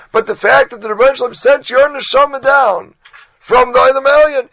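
impassioned loud high reproach to Philip; furious preaching shout. Retold or imitated. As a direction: shake him with every word. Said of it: "Reb Chanan lost it".

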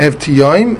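Ev Tiyoim with hard t and an ee after it; "Ev Tiyoim", the middle syllable a clear tee; correct pronunciation. Shown as a demonstration. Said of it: "with the chirik, like we said".

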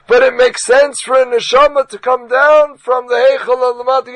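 impassioned loud high reproach to Philip; furious preaching shout. Retold or imitated. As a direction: shake him with every word. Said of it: "Reb Chanan lost it".

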